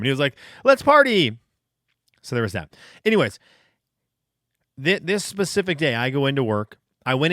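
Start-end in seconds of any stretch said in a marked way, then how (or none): 0.6-1.4 cheerful party call. Retold or imitated. Coworker caught with stolen TV.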